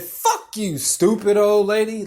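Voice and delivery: gleefully re-enacted insult; mock angry insult. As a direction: laughingly hurl it at her. Retold insult to old lady.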